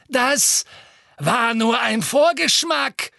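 high, loud, slow gloating; triumphant villainess menace; Bellatrix. A narrator's reading. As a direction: threaten worse with cruel relish. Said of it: "sagte Bellatrix".

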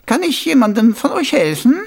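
put-on high, loud, testy old lady; irritated voice. A narrator's reading. Old shopkeeper to soldiers crowding her shop, irritated. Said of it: "erklang eine gereizte Stimme".

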